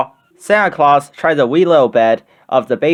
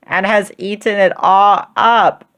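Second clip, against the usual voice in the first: high, slow little cub's complaint; wee little voice; 7 semitones higher than usual. A narrator's reading. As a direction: draw it out in a tiny bear's high voice. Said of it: "in his wee little voice".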